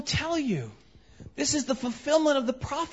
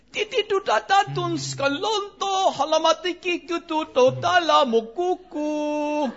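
higher, loud gibberish chatter; higher gibberish, last word slowed; mock tongues; 5 semitones higher, 4 dB louder than usual. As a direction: rattle off made-up tongues.

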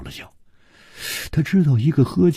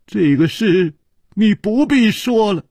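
high, tight, forced interruption; through gritted teeth; 13 semitones higher, 4 dB louder than usual. A narrator's reading. Li stopping his wife's confession, through gritted teeth. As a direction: cut in tensely with teeth clenched. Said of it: "咬着牙说".

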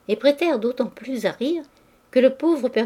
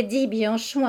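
ordinary delivery, nothing marked

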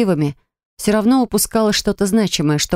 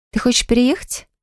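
insinuating; 6 semitones higher than usual. Acted. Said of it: "вкрадчиво спросила я".

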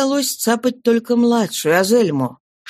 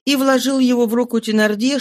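ordinary delivery, nothing marked

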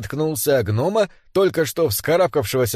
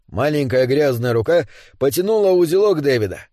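ordinary delivery, nothing marked

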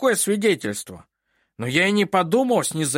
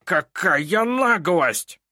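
slow, high outraged judge voice; hissed; 8 semitones higher, 4 dB louder, much slower than usual. A narrator's reading. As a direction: drag it out in outrage. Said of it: "прошипел Снивель". (судья шипит от возмущения)

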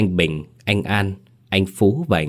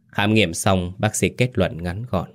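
ordinary delivery, nothing marked

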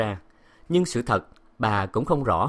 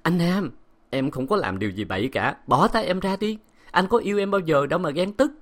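higher, hurting plea; pained wife; 8 semitones higher than usual. A narrator's reading. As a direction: beg him to let go. Wife in pain begs husband.

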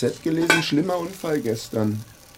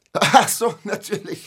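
lachend; 9 semitones higher than usual. Acted. Lacht überrascht.

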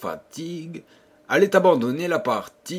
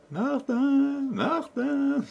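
much higher, teasing echo voice; mocking echo; 14 semitones higher, much slower than usual. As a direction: echo the name back like a cheeky hidden child. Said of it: "qui répétait en ricanant".

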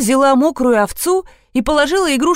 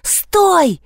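high cry to halt; mild yell; 8 semitones higher than usual. A narrator's reading. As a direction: call out in alarm. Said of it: "заорала я". (высокий окрик)

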